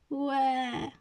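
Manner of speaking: high, drawn-out acted whine; mock child's wail. A narrator's reading. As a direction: wail it like a little kid crying. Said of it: "zapłakał Franek".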